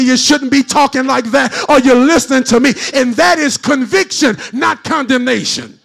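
chanted, whooping sermon cadence; shout-sing the sermon over the music; preaching at full force. Acted. Preacher whooping to the congregation, full force.

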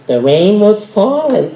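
flat, silly throwaway read; stupid way. Shown as a demonstration. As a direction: say it the dumb way. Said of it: "I won't say it stupid like that".

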